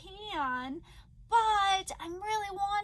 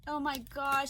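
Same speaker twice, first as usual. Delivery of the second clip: usual speaking voice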